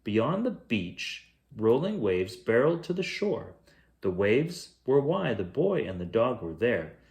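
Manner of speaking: plain reading voice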